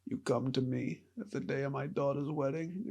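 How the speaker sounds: soft, slightly off mob boss impression; quiet, half-hearted crime boss voice; Godfather. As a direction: do a hushed mafia don. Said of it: "a perfect Godfather impression".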